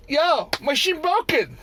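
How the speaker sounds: high mocking broken-English voice; mock worker accent. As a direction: imitate the workers' clipped report. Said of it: "they go".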